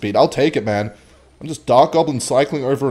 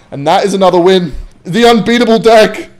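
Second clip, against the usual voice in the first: loud high victory cry; triumphant; 7 semitones higher, 10 dB louder than usual. Real, not acted. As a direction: crow over the win. Celebrating another win.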